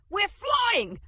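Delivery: loud, high squealed shout. A narrator's reading. Uncle in a swapped voice, shouting. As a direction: shout it in a shrill girlish voice. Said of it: "shouted in Christine's voice".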